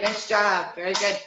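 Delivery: ordinary delivery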